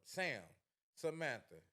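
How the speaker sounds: giving his therapist a loud shout-out; calling out. Real, not acted. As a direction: hail her warmly, like spotting her across a room.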